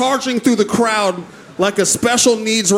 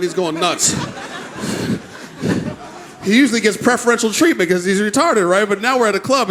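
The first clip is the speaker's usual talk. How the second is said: ordinary talk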